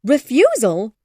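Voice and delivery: Refusal? cried high and loud with a rise; appalled mother echoing Refusal?; shocked. A narrator's reading. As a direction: gasp it out in disbelief. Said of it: "cried Missus Bennet, shocked".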